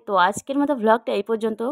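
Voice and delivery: normal talking voice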